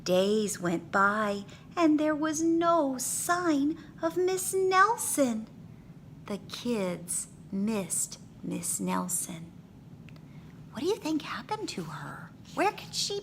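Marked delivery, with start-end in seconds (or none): none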